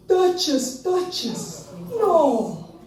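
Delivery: high, falling cry of a bereft owner; retold anguished calling; distressed old man. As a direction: call out for a pet in dismay. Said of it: "there's an old man in his yard".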